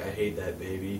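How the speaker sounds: muttered hate. Retold or imitated. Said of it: "he's like".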